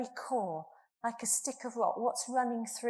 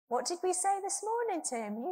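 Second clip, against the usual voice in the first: much higher, calling out the church chant to the congregation; call-and-response; 12 semitones higher, 4 dB louder than usual. Acted. Preacher calls the morning refrain back to the congregation, high and ringing. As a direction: lead the room in the refrain.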